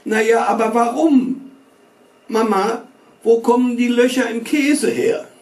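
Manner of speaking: put-on high, whiny little boy pestering; whiny boy's voice. A narrator's reading. Little boy whining to his mother at dinner. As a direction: whine it up high like a nagging child. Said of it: "Eine weinerliche Jungenstimme".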